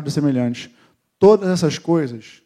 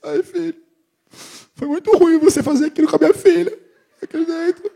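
high, wavering mock crying; wife's tearful complaint; 3 dB louder than usual. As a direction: mimic the wife on the verge of tears. Esposa chorando reclama. (imitando a esposa chorosa, voz aguda)

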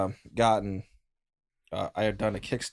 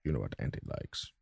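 tender auntie voice. Acted. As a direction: say it like a loving old aunt. Aunt farewell to goblin.

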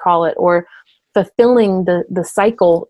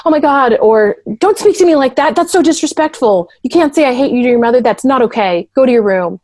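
higher, louder scolding; escalated parent; 4 semitones higher, 3 dB louder than usual. Retold or imitated. Mother scolding a disrespectful child, higher and louder. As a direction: play the parent losing it at the child. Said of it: "not getting up here and being".

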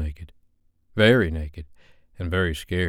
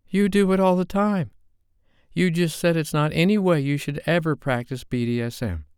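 put-on higher young woman, hurt and hot; Sophie, accusing; 10 semitones higher than usual. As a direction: throw the accusation back at him, pitched up.

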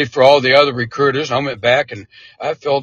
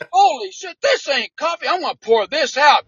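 disgusted; 10 semitones higher than usual. Retold or imitated. Commander disgusted by coffee.

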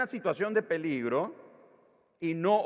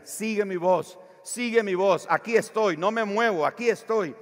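loud, higher repeated calls; shouting; 5 semitones higher, 6 dB louder than usual. Retold or imitated. Father shouting to daughter in the fire, steady call. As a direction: keep calling so she can find you. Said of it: "porque él le gritaba".

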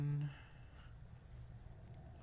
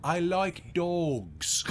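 put-on Snatch caravanner accent; 4 semitones higher than usual. GM imitates the boxer from Snatch for the players. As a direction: mumble it like the Irish traveller from Snatch. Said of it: "the caravanners from Snatch".

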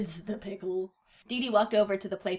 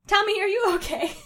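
laughing while reading; 12 semitones higher, 8 dB louder than usual. Acted. Baby voice read with laugh.

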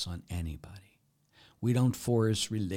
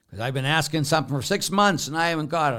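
higher, louder, fast whiny grievance; mock complaint; 8 semitones higher, 9 dB louder, faster than usual. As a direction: whine like an impatient believer. Complaining person quoted.

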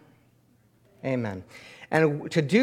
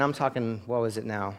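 ordinary delivery

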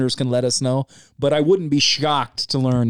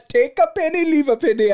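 put-on much higher Indian accent, laughing; Apu voice; 13 semitones higher than usual. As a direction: mimic Apu's shop line in an Indian accent. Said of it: "Apu from The Simpsons".

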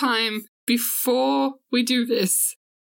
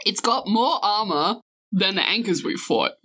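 usual speaking voice

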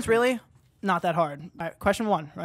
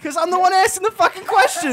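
high, loud self-defence; loud, high-pitched indignant outburst; indignant shout; 11 semitones higher, 10 dB louder than usual. Real, not acted. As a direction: yell your excuse at full volume. Host protests being given the snack.

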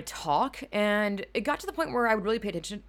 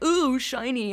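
mock dazzled ooh; 6 semitones higher, 5 dB louder, slower than usual. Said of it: "keyboards that's like".

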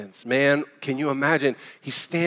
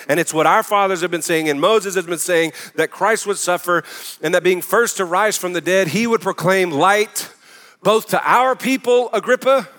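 raised, loud pulpit proclamation; preaching at full force; 5 semitones higher, 6 dB louder than usual. Real, not acted. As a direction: build the proclamation higher toward the final address.

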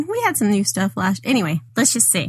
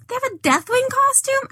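high, amazed question; surprised; 13 semitones higher than usual. Real, not acted. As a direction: ask it in disbelief. Amazed at the costume news.

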